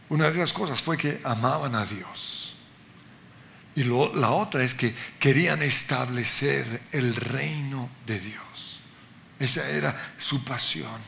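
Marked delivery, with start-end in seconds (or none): none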